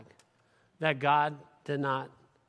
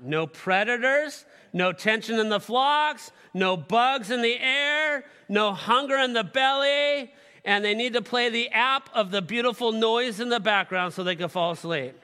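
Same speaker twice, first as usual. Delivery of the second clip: mocking; 6 semitones higher, 5 dB louder than usual. Mocking sheep's needs rising.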